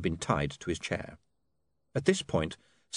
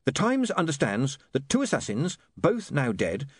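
higher-pitched, formal dictation; editor dictating news; 6 semitones higher than usual. Acted. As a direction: dictate the article phrase by phrase. Voices William dictating an article.

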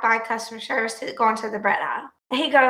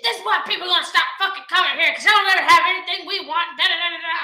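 higher, fast, huffy complaint; mocking the angry customer; 5 semitones higher, faster than usual. Customer to cashier, higher huffy mocking complaint. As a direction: mimic the rude man griping. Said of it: "He just loses his shit".

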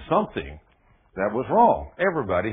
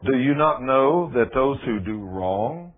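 normal talking voice

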